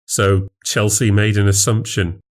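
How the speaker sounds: plain reading voice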